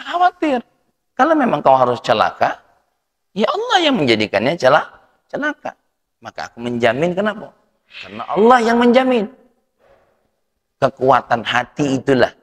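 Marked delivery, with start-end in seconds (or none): 0.1-9.3 rousing pulpit delivery; fiery preaching. Acted. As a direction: preach it out to the hall. Preaching at full force.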